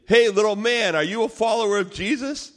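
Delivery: loud, high, booming challenge; intimidating giant. Retold or imitated. Giant towering over little Miguel, loud intimidating challenge. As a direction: loom over him and call him out loudly.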